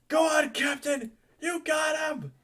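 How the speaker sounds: high, loud battle cheer. Acted. Pirate's son cheering on the captain, high shout. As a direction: cheer him on at full voice. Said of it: "he's gonna just cheer on his father".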